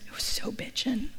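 whispered, mock-reverent slang; breathy, hushed imitation of awe; awestruck dude husband. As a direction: whisper it in dazed amazement. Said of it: "And he goes".

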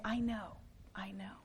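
hushed, gentle reassurance; tender comfort. Acted. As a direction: comfort someone in pain, barely above a breath. God sitting with the sufferer, comforting.

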